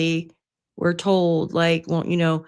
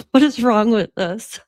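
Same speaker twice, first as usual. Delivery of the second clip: real laughter bursting around the words; laughing hard; 7 semitones higher, 4 dB louder than usual. Real, not acted. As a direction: get it out between fits of laughter. Cracking up at their own video.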